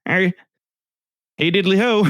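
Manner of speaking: cheery goofy Flanders greeting; Ned Flanders. Retold or imitated. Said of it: "Ned Flanders".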